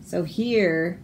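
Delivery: usual speaking voice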